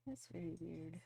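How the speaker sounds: normal talking voice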